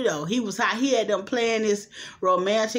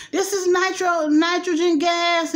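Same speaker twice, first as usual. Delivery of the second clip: raised, high-pitched holler; 8 semitones higher, 4 dB louder than usual. Retold or imitated. Man warning the room about the gas, hollering. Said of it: "hollered out".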